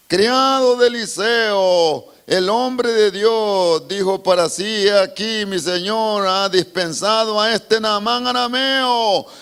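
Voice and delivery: high, loud, slow preaching read; fervent scripture reading. Acted. Preacher reading the verse about Gehazi to the church, fervent.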